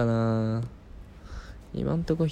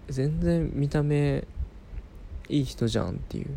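normal talking voice

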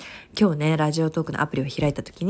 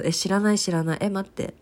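ordinary delivery

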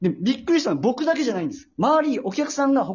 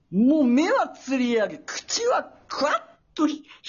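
heighten it, stretching the frightening details; more demonic telling; 5 semitones higher than usual. Shown as a demonstration. Retelling the demon customer after coaching, with heightened drama. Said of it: "もうちょっと同じ内容でいいから".

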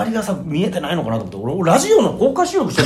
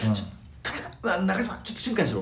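hushed retort; 10 dB quieter than usual. Retold or imitated. Hushing friend on the train.

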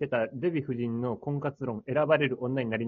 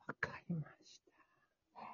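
whispered; 3 semitones higher, 15 dB quieter than usual. Real, not acted. Whisper while chewing kiwi.

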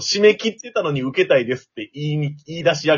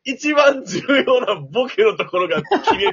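comic wail; 8 semitones higher than usual. Real, not acted. Complains joke got cut off.

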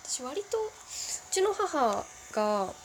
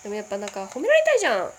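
loud, fast, mock-irritated; half-snapping; 9 dB louder, faster than usual. Real, not acted. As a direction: blurt it out half angry. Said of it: "切れ気味".

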